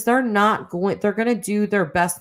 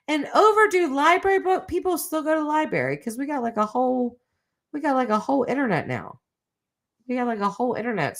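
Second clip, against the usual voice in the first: higher, fast amused disbelief; incredulous; 7 semitones higher, faster than usual. Real, not acted. To chat about library felonies, high incredulous rant. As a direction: pitch it high, can't believe it.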